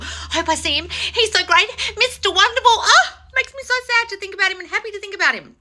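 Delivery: high, giggly swooning over Mister Wonderful; mock lovestruck swooning. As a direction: send up the pedestal crush. Mocking a viewer idolising crush.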